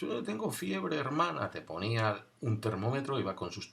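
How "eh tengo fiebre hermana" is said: voz aguda de niño con fiebre; niño enfermo. Retold or imitated. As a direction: imita a un niño pequeño quejándose. Niño enfermo responde a monja. (niño enfermo hablando con la monja, con voz aguda y aniñada)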